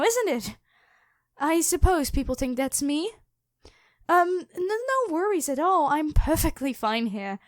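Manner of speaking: high, sweet, nervous girl voice pretending to be harmless; fake innocent maiden. Dragon posing as the maiden, shooing the hero away in a high nervous voice. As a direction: play a jittery captive who insists she's fine.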